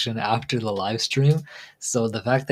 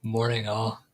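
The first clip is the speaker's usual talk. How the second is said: ordinary talk, nothing marked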